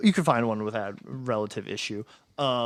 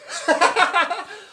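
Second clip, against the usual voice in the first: high laugh; 5 dB louder than usual. Real, not acted. Laughter at the rumor.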